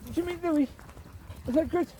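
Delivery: cooing at the dog. Real, not acted. Baby talk to her dog.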